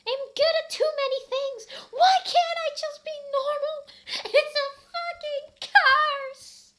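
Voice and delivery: very high, loud shriek, slowing on the last words; hammed-up shout. Ebony shouting at Draco, very high screech. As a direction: screech out the curse. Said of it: "I shouted".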